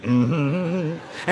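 rhythmic bluesy "mm-hmm"; demonstrated humming. Retold or imitated. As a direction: hum as if moving to the beat. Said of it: "You got to move a little bit".

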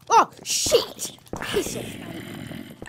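startled high cry then cockney swear; cockney outburst. Acted. Gamer swearing in cockney when angry. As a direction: snap into cockney. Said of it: "I turn into a cockney".

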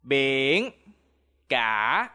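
Biển cả with cả dipping low; Vietnamese tones. Shown as a demonstration. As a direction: drop cả low, then let it rise. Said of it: "Repeat after me".

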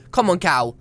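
usual speaking voice

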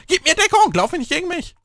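high, loud urgent yell; urgent shout. Real, not acted. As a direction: shout the order at teammates. Calling to teammates in game.

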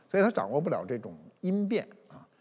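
ordinary talk

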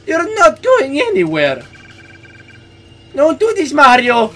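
high, loud desperate shouts; panicked pleading. Acted. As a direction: beg him to stop, frantic. Voicing Mario pleading with Luigi.

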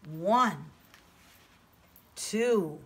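normal talking voice

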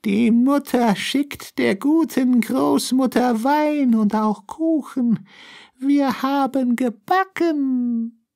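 sweet and high to fool the grandmother; disguised voice. A narrator's reading. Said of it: "rief der Wolf mit verstellter Stimme".

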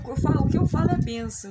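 loud, fast declaration; proud exclaim. Real, not acted. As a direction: proclaim it proudly.

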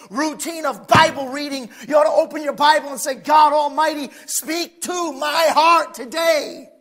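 loud, rising preacher's cry; fiery preaching. Real, not acted. Preacher exhorting the congregation to pray, building to a shout.

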